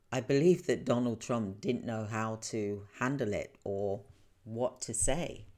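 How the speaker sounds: ordinary talk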